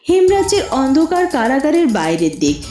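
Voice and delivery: ordinary delivery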